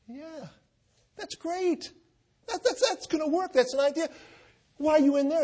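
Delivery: hammed-up exasperated driver, sarcastic; exasperated sarcasm. Stranded driver sneers at the inmate, high sarcastic. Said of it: "out of exasperation went".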